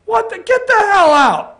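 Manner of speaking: high, loud mock Trump blowing up; Trump impression. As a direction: bark it like an outraged Trump. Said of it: "Trump said".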